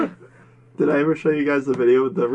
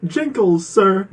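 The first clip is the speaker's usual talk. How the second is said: raised, louder comic retort; mock correcting customer; 6 semitones higher, 5 dB louder, much slower than usual. Answering the Boston guy's challenge at the parlor, mock affronted. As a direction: pitch it up like an affronted reply. Acted reply to outraged customer.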